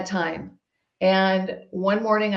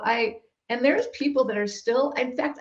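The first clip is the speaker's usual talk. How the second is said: usual speaking voice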